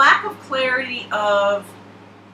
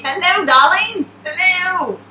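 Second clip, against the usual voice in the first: high, loud sing-song hello to a card; playful flirty greeting; 9 semitones higher, 7 dB louder, slower than usual. Pretend. Reader greeting the Sag card, playful and flirty. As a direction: coo at the card like a charmer.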